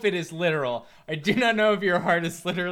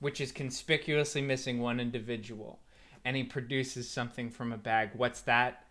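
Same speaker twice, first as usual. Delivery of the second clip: dramatic narration; 4 semitones lower, 3 dB quieter than usual. Acted. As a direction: tell it like a grim trailer.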